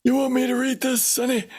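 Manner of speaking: put-on high, thin old man voice; creaky granddad asking to read. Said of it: "the old man voice".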